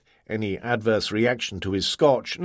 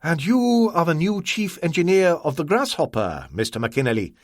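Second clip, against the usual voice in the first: higher, cool merchant voice; Ah Wong, assessing; 6 semitones higher than usual. Acted. Ah Wong speaks.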